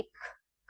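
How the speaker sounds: unvoiced breath pushed out on کھ; breathy kh hiss. Shown as a demonstration. Teacher demonstrating the whispered hams sound, breathy release. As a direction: let کھ escape as pure air, no voice. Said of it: "کھ کرتی ہوئی باہر آتی ہے".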